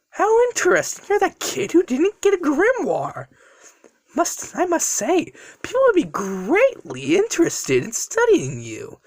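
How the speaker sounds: high-pitched, creepy villain voice; intrigued villain. Acted. Villain toying with the captive.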